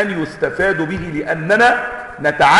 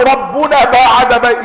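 شكوى متذمرة; 6 semitones higher, 5 dB louder than usual. Retold or imitated. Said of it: "اشتكوا".

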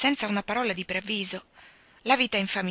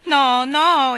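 loud, higher begging; pleading; 7 semitones higher, 12 dB louder than usual. A narrator's reading. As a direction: beg them not to. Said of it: "implorarono umilmente". (supplica acuta)

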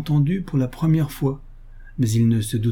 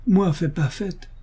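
higher, slow, vexed protest; put out; 7 semitones higher, slower than usual. A narrator's reading. Gardener answering his mistress's scolding, put out. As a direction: protest as if thwarted. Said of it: "dit le Chinois d'un air contrarié".

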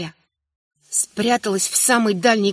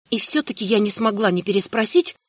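ordinary delivery

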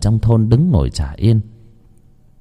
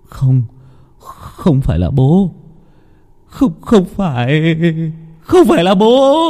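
panicked, rising higher to a frightened cry; terrified denial; 7 semitones higher, slower than usual. A narrator's reading. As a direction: deny it in rising terror. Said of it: "Thằng Lực sợ hãi hét lên".

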